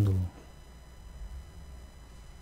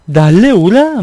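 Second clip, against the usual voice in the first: loud, high North Korean command; a North Korean dialect order, loud and high; North Korean accent; 7 semitones higher, 9 dB louder, slower than usual. Shown as a demonstration. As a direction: bark it like a North Korean officer. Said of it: "막 이런 거".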